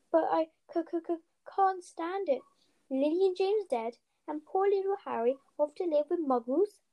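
high, halting tearful giant's voice; stammering through tears. A narrator's reading. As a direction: choke on the words as the tears come. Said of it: "sobbed Hagrid".

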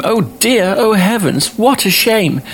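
very high, sweet-mannered exclamation; high mock-dismayed nice girl; nice girl exclaiming. A narrator's reading. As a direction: exclaim it like a proper little girl, pitched right up. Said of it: "A nice girl would at once exclaim".

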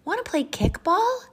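high, loud playmate voice; cartoon friend's bouncy invitation; eager young friend. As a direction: ask like an excited pal. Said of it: "asked Buddy".

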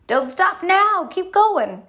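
playful imitation of a personal trainer urging on; coach voice. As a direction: pump them up like a gym coach. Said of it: "want someone to tell me".

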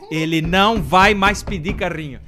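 loud, slow mock fury; mother's loud slow retold rage; furious mother. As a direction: hammer each word down in anger.

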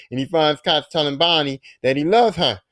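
usual speaking voice

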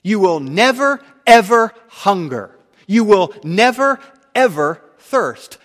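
"never" hit hard, loud and high each time; rising shout on "You will never, ever"; emphatic never. Acted. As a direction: pound "never, ever" like a hammer. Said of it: "These words are emphatic".

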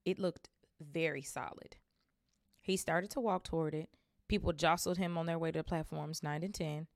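plain reading voice

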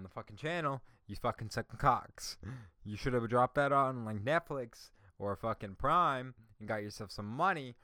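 venting real anger at the channel; angry rant. Real, not acted. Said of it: "I was really getting on my fucking nerves".

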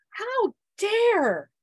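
how dare, high and loud, drawn out; furious how dare. Retold or imitated. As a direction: fling the words at the kids. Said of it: "I was furious".

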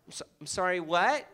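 put-on baffled American accent; mimicked American accent. American not understanding water order, mock American accent. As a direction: ask it back like a confused American.

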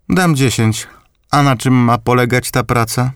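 put-on high old grandmother's voice; grandma voice. A narrator's reading. Grandmother bids and questions her grandson, voiced high. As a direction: bid sharply, then ask curiously.

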